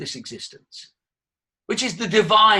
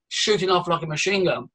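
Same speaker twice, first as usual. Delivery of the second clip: ordinary delivery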